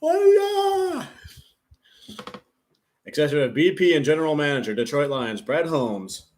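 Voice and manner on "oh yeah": very high, drawn-out mock cheer; stretched, high-pitched whoop; Tom Grassi. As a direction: imitate an overexcited fan yelling. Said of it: "I see Grassi doing this, like".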